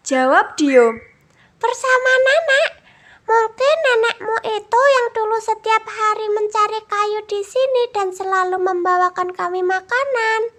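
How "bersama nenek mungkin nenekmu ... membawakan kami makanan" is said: very high little rabbit; a small excited rabbit remembering; high bunny voice, eager. A narrator's reading. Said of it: "jawab kelinci".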